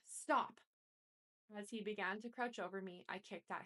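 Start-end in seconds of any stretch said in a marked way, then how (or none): 0.0-0.6 loud, high scream of Stop. A narrator's reading. Kimora screaming as Sean lunges at her friend. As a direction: yell it to stop the attack. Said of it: "Kimora screamed".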